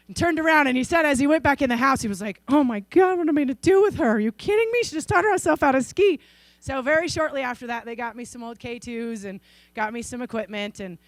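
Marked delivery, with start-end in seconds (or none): 2.5-6.2 higher, exasperated amazement; dad marveling at his daughter walking in; amazed dad. Retold or imitated. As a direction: throw up your hands in disbelief. Dad's amazed aside.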